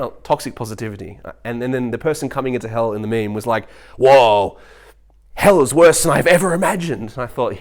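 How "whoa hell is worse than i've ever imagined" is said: loud, raised-pitch newcomer's shock; hammed-up appalled awe; mock horrified amazement. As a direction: exclaim it as if just arriving in hell. Meme newcomer arriving in hell.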